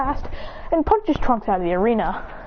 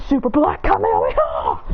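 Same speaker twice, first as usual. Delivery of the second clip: deep, roaring attack name; attack shout. Acted. Firing a special attack.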